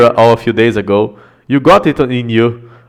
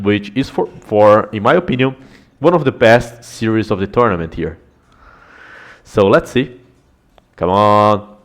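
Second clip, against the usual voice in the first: ordinary talk, nothing marked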